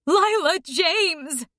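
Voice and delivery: high, slow shout through tears; crying shout. A narrator's reading. Mother shouting her daughter's name while crying. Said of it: "she shouts, still crying".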